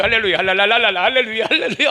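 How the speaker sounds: fast, loud, jubilant hallelujahs; joyful shout of praise. Shown as a demonstration. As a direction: rattle off praise with glee. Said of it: "기뻐하면 돼요".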